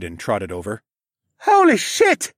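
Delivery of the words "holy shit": loud, high scream. A narrator's reading. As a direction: scream it from below in panic. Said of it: "someone below us screamed".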